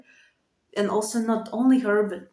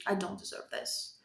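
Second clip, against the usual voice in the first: hushed self-doubt; 2 semitones lower, 12 dB quieter than usual. Retold or imitated. Quoting her self-doubting self.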